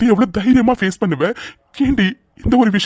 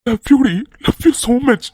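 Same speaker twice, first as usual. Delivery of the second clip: tender declaration; 5 semitones higher, much slower than usual. Acted. Husband's tearful declaration.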